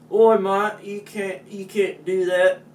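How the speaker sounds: loud, high mock British scolding; hammed-up British accent telling off. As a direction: shout it like a cartoon Brit. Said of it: "The Brits went".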